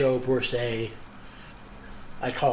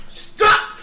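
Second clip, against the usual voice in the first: high loud shriek breaking into a laugh; laughing scream; 9 dB louder than usual. Acted. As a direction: scream it while cracking up. Another mock scream.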